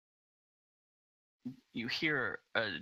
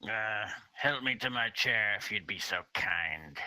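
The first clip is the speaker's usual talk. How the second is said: louder, put-on old man's voice; old lord; 4 dB louder than usual. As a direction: ask for help as an aged lord. Old man with cane asks help.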